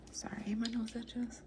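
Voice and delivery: whispered aside; breathy hushed apology; quiet at night. Real, not acted. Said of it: "sorry if it sounds like I'm, like, whispering".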